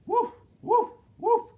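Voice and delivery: very high, loud little woofs; dog barking. A narrator's reading. Reader to children as the dog, high loud barking. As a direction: yip it like a dog. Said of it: "barked the dog".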